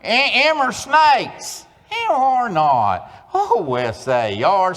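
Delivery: high, slow, loud twang; exaggerated Oklahoma twang; Oklahoma twang reading. Shown as a demonstration. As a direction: lead the room in a drawled chant. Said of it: "second grade reading passage".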